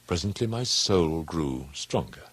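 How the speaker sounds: usual speaking voice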